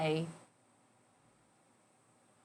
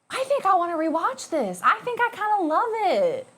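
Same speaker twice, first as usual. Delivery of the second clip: put-on high, posh-ish British accent; 8 semitones higher than usual. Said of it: "are you British? You sound British".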